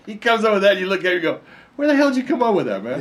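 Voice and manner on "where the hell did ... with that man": incredulous. Retold or imitated. Asking where he got that line.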